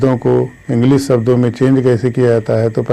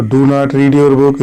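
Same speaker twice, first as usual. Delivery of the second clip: slow example; much slower than usual. Shown as a demonstration. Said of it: "इसका जब passive voice बनाएंगे".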